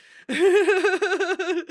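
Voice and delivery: loud high burst of laughter; big uncontrolled cackle; real laugh. Real, not acted. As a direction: crack up loudly, pitch shooting up. Cracks up at own accent.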